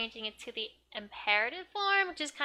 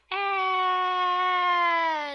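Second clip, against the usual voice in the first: long, high, loud "ah" scream; acted scream; 8 semitones higher, 11 dB louder than usual. Girl reacts to the gunshot on TV, screaming. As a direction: hold a loud high scream in mock horror.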